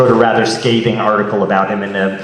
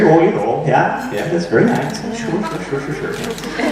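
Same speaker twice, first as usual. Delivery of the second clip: light Canadian accent, higher and fading to near unvoiced on the sures; a passive-aggressive Canadian accent that trails off; exaggerated Canadian accent; 6 semitones higher than usual. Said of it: "it's very Canadian".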